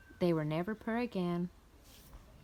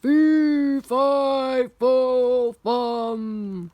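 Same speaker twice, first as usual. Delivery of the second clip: very loud, furious chant; roaring giant; 3 semitones higher, 10 dB louder, much slower than usual. A narrator's reading. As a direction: roar each word out like a giant. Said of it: "roared the giant".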